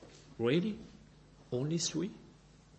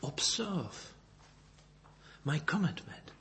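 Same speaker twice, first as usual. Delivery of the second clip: whispered Observe; 9 dB quieter than usual. Shown as a demonstration. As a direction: breathe Observe with no voice. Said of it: "Also something interesting".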